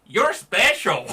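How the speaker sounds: high, loud, slow mocking sweetness; a sarcastic compliment meaning goober; sarcastic "You're special". Shown as a demonstration. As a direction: drip sarcasm on it, stretched out. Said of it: "I can sit here and say".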